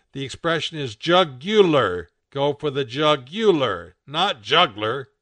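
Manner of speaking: "jugular" pressed out loud, set against "juggler"; emphatic correction. A narrator's reading. Rob corrects Bucky's expression, stressing "jugular" over "juggler".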